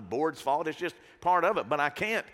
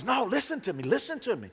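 insisting; 7 semitones higher than usual. Retold or imitated. Retold friend insisting.

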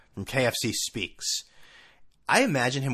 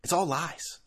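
normal talking voice

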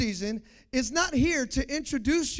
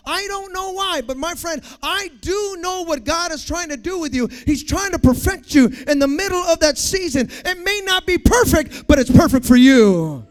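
loud, high, building sermon delivery; full-force preaching; 7 semitones higher, 13 dB louder than usual. Real, not acted. Preacher encouraging a friend through a hard season, full-force preaching. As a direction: build to a booming declaration.

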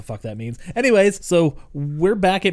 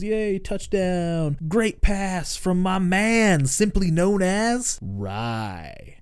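slow, drawn-out, higher put-on cheer; mock gushing kid; 5 semitones higher, much slower than usual. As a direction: ham up a corny kid cheering his buddy. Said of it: "and Chucky goes".

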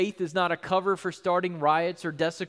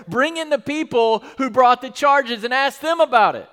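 higher and louder, challenging; Paul demanding; 6 semitones higher, 7 dB louder than usual. Retold or imitated. Paul before the governor demanding the accusers, loud. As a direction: call out the absent accusers. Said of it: "Paul's like".

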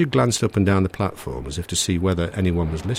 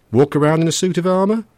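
higher, louder teasing question; humouring him; 13 semitones higher, 8 dB louder than usual. A narrator's reading. Narrator joking to calm nervous Richard, humouring. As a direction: tease him to lighten the mood. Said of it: "in an attempt to humour him".

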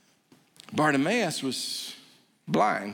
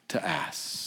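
whispered ending; 11 semitones lower, 7 dB quieter than usual. Real, not acted. Sermon line trailing off.

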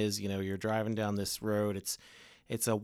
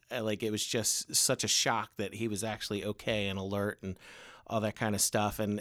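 usual speaking voice

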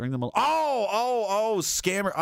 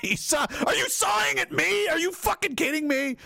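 incredulous yell; 10 semitones higher than usual. Real, not acted. Yelling at scam phone call.

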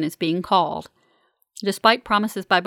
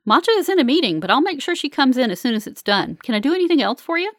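high, upbeat, quicker by the end; chirpy cheerful; 6 semitones higher, 3 dB louder than usual. A narrator's reading. Technician dodging the patient's questions, chirpy and cheerful. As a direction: keep the smile plastered on. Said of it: "retains its chirpy, cheerful tone".

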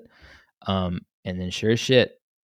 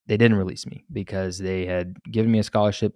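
usual speaking voice

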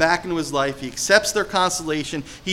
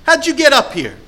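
high, loud confronting question; grandmother confronting; 10 semitones higher, 7 dB louder than usual. Retold or imitated. Grandmother confronting a man at her door, loud. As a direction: challenge the unwelcome visitor head on. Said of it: "she said to him".

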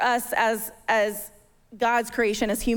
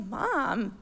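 put-on sassy kid; 7 semitones higher, 3 dB quieter, much slower than usual. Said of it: "she had sass".

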